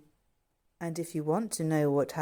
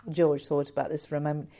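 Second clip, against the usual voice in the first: usual speaking voice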